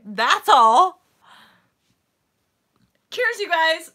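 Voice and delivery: loud bright sign-off; cheery. Real, not acted. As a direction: wrap it up with a cheerful punch.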